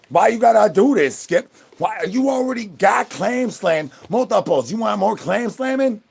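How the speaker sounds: loud mock scold at the cat. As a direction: call Skip out in fake outrage. Scolding Skip for tattling.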